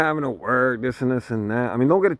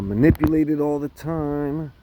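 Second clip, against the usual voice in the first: ordinary delivery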